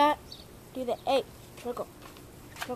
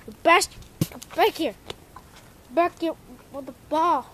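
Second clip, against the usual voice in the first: loud, higher angry yell; yelling at dog; 4 semitones higher, 8 dB louder than usual. Real, not acted. Owner yelling at the dog to bring the ball, loud angry shout. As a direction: shout him back with the ball.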